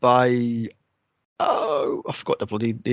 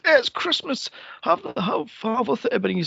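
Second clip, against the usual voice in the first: imitating young Scrooge; 10 semitones higher than usual. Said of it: "He goes".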